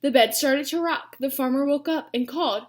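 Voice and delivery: plain reading voice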